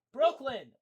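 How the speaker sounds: very loud, high yell; shouting at the dog. Real, not acted. Reader yelling at the barking dog. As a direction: yell the dog's name. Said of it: "deal with him".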